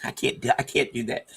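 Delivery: ordinary talk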